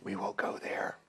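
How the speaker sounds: hushed aside, breath only; whispering. Real, not acted. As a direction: whisper it like a secret. Joke cut short, PG remark.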